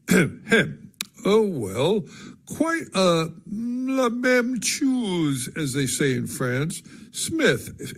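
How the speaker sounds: slow, lingering delivery with syllables stretched; drawn-out syllables. A narrator's reading. Theodore gossiping to the narrator in slow, stretched syllables. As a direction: draw every phrase out lazily, leaning on words. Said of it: "in his well-known peculiar way of drawing out his syllables".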